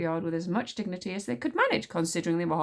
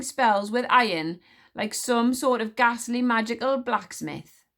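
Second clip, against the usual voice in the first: louder, slow and razor-sharp command; brisk, cutting; 5 semitones higher, 5 dB louder than usual. A narrator's reading. Queen commanding the crowd to step forward, cutting. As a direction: slice each word like a knife. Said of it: "her voice was as brisk and as meaningful as a freshly sharpened knife".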